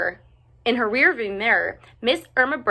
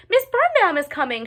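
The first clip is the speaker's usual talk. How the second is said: pitched even higher, near a shriek; shouting louder; 10 semitones higher, 4 dB louder than usual. A narrator's reading. Ruby's second, more panicked warning call. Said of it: "shouted Ruby".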